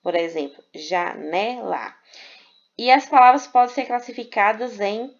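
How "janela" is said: janela slowly split into ja-ne-la; syllable by syllable. Shown as a demonstration. Teacher showing students a three-syllable word, janela said syllable by syllable. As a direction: say janela in three separate beats, ja, ne, la. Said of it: "três sílabas".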